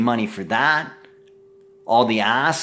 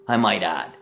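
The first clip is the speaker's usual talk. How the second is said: ordinary delivery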